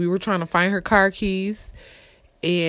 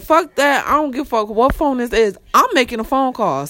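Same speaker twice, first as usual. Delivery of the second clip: higher and louder, fired-up defiance; defiant retold outburst; 5 semitones higher, 4 dB louder than usual. Alone in the paddy wagon with a phone, higher defiant outburst. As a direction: blurt it out, mind made up. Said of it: "My first instinct was like".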